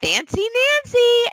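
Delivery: put-on high, bright little-girl call to the remote; kid's voice command. Mom imitating her daughter talking to the TV remote, high child voice. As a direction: chirp it like a small child. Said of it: "and she says".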